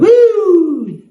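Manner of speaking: apito agudo e alto como sirene; sirene de alerta. Retold or imitated. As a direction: soar como um alarme no peito. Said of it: "o Espírito Santo". (o Espírito Santo apitando como sirene)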